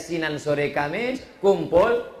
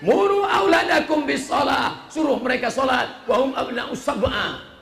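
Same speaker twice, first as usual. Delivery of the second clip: forceful proclamation; 6 semitones higher, 4 dB louder than usual. Real, not acted. Preacher quoting a command on children's prayer, forceful proclamation. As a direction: proclaim it forcefully, drawing out the words. Proclaims Arabic saying, then translates.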